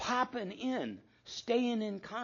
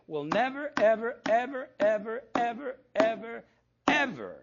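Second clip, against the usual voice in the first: each ever hit louder and higher; emphatic nevers; 5 semitones higher, 6 dB louder than usual. Retold or imitated. Larry vowing at the marriage retreat, each ever stressed louder. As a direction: pound every ever harder. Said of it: "and this is how he would say it".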